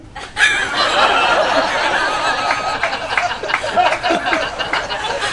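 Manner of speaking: a long, very high, real laughing fit; cracking up. Real, not acted. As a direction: lose it at your own punchline. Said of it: "That one got me".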